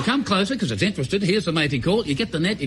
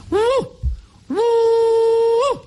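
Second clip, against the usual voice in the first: high, loud hooting yell; bunyip mating call; 4 dB louder than usual. Retold or imitated. Injured hunter retelling his mating call at the cave, loud high hoot. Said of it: "I remembered the mating call".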